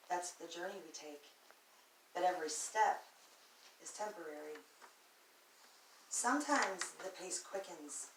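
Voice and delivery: dramatic recital, swelling on key phrases and dropping away; spoken-word poem. Acted. Speaker counselling on highs and falls, dramatic spoken word. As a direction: build with the tumbling images, then settle into spaced lines. Continues reciting the second piece.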